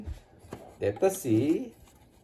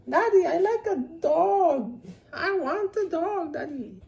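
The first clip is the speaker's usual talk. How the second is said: choramingo de criança; 9 semitones higher than usual. Acted. George begs for a dog.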